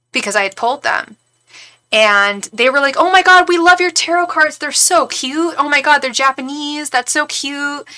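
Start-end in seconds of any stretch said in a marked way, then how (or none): 3.0-7.9 high, mock girly gush. Friends admire the tarot cards on the table, mock gushing. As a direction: imitate friends fawning, loud at first.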